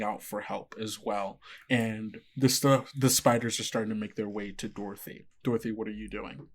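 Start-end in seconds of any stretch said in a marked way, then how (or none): none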